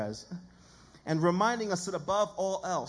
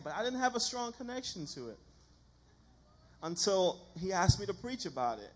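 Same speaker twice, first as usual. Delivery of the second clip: usual speaking voice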